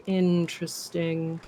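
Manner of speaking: soft, drawn-out "Interesting"; mock polite, slow and quiet; insincere interest. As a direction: stretch "Interesting" as if not meaning it. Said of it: "says interesting when you know they don't mean it".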